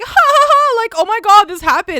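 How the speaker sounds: high, loud imitation of a laughing group; mock laughing. Kids laughing together in a corner at school, mock laughing. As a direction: put on exaggerated laughter, loudly mimicking the crowd. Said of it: "like, laughing".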